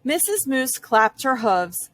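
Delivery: ordinary delivery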